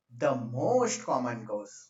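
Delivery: "most common cause" drawn out very slowly; slow dictation. Real, not acted. Dictating while writing it out.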